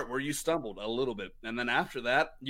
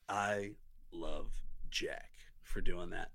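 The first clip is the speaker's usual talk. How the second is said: sincere; 10 semitones lower, 7 dB quieter, slower than usual. Real, not acted. Sincere thanks to cohost.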